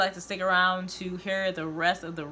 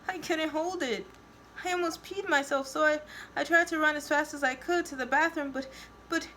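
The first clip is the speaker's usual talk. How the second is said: high whimpering voice, halting at the end; tearful confession; 10 semitones higher than usual. A narrator's reading. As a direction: plead in a much higher, tearful voice. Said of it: "she cries".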